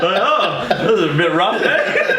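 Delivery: high, laughing "a bit rough, eh"; mock concerned performer. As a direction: tease it like a worried colleague. Other performers see him crying.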